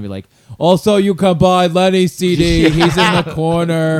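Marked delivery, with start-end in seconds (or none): 0.6-2.7 loud, high, slow mock announcer; booming, put-on merch pitch; Club Soda Kenny. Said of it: "Club Soda Kenny would stand there". 2.7-4.0 loud, high, slow mock announcer; booming, put-on merch pitch; Club Soda Kenny. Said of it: "Club Soda Kenny would stand there".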